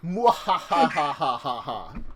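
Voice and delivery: slow drawn-out villain cackle; evil laugh. Pretend. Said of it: "I'm so evil that way".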